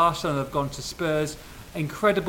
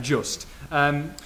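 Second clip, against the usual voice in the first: ordinary delivery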